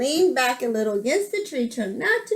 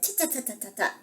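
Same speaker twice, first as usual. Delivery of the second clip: soft, staccato monkey noises; quiet storybook monkey chitter; monkey chatter; 9 dB quieter, much faster than usual. A narrator's reading. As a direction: imitate mocking monkeys in little repeated syllables. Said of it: "monkeys only shook their fingers".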